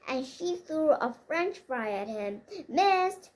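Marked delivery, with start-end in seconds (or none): 2.7-3.3 loud, high gloating cry; husband taunts his wife across the table; taunting shout. A narrator's reading. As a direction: jeer as the fry flies past. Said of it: "shouted Sandy".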